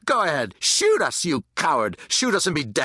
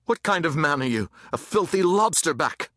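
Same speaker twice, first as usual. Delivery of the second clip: higher-pitched mocking challenge; jeering taunt; 6 semitones higher than usual. A narrator's reading. Boy from the mob baits a soldier, jeering. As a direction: hurl it as an insult.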